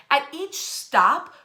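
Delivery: ordinary talk, nothing marked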